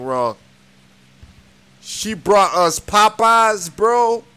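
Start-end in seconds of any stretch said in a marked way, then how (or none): 1.8-4.2 dismayed disbelief. Real, not acted. Dismayed at Popeyes on Thanksgiving.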